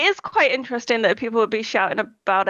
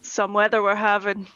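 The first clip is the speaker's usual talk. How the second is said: normal talking voice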